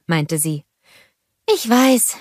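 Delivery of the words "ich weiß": sighed admission, higher than narration; mild sigh. A narrator's reading. Sister admitting a useless purchase, mildly sighed. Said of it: "seufzte Anna".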